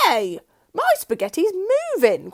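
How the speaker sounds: loud startled cry; cry of alarm. A narrator's reading. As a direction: jump back from the plate. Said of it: "cried Mister Twit".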